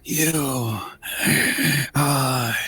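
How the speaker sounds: very slow, breath-heavy rasp; menacing coffin creature. Acted. Coffin figure naming the intruders its enemies, slow whispery menace. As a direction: leave long gaps, rasping out each word.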